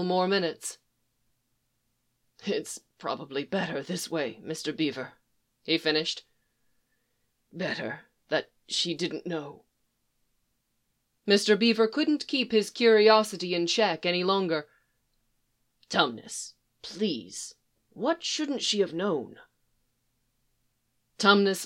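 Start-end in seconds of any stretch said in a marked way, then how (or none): 2.4-9.6 resigned murmur. Acted. Tumnus resigned after babbling. 15.9-19.4 hushed, gentle plea; soft coaxing. Acted. Beaver coaxing the secret.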